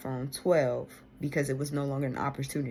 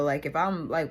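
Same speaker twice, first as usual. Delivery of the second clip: ordinary talk, nothing marked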